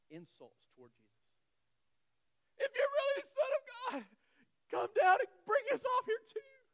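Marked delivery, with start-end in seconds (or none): 2.6-6.6 loud, high jeering shout; mocking yell. Thief on the cross jeering loudly at Jesus. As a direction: hurl the taunt up at Jesus. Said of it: "at least one of them yelled".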